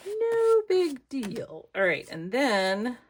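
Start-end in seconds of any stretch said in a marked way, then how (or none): 0.1-1.7 stretched out very high and slow; sing-song it off like nothing; high slow reassurance. Real, not acted. Crafter brushing off a mistake, high slow and drawn out. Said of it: "consoling herself".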